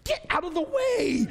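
breaks into a high laugh. Real, not acted. Preacher joking to the women, laughing. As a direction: squeal it and laugh.

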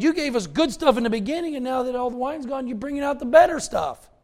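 mock the steward griping about cheap wine; wine steward complaining. Preacher voices wine steward quote.

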